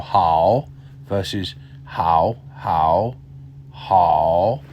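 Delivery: "how" from deep in an open throat. Shown as a demonstration. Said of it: "I open my throat a little bit more".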